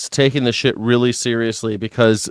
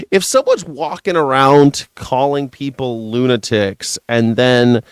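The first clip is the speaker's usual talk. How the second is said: normal talking voice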